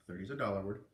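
ordinary delivery, nothing marked

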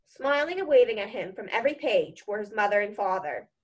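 usual speaking voice

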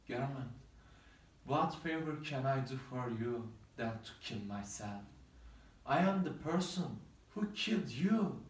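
a lover's quiet, grieving farewell; grieving lament. Acted. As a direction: mourn over her body, hushed. Romeo grieves over Juliet's body.

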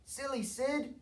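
high, shrill exasperated cry; neighbours crying out. A narrator's reading. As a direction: moan at the noisy dog. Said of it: "they cried".

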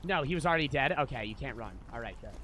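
ordinary talk, nothing marked

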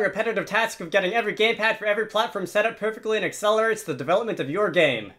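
hyped infomercial announcer; ad pitch. Acted. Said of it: "pseudo-marketing pitch".

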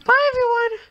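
very high, loud squeaky goodbye. Real, not acted. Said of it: "my squeaky voice".

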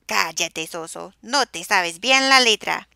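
loud scolding yell. A narrator's reading. Family yells at Soso to shut up, loud. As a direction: shout him down. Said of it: "todos le gritaban".

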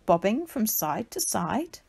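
plain reading voice

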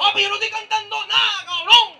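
grito agudo y mucho más fuerte; grito fingido. Acted. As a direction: grita en broma, ofendido. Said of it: "me estás gritando". (grito actuado contra el compañero de escena)

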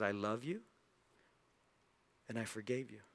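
low, soft, with a long pause; gentle and hushed, letting each part settle; tender forgiveness. Acted. Preacher voicing God forgiving the listener, tender and low. God's forgiveness voiced to congregation.

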